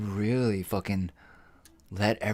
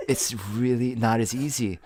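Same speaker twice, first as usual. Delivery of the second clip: ordinary talk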